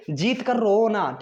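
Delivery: slowly spelled-out key phrase; slow mnemonic. Shown as a demonstration. Teacher to students, drilling the mnemonic slowly. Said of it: "रंजीते".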